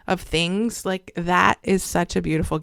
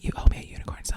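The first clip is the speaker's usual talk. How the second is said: playful whispered reminder of a debt; whispered aside; 5 dB quieter, much faster than usual. Pretend. Whispering to Jack, who owes her a song. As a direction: whisper it as a mock demand.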